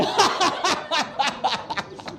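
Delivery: long manic laughing fit; crazy laugh. Shown as a demonstration. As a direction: laugh like a madman. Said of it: "पागलों की तरह हंसें". (पागलों जैसी लंबी हँसी)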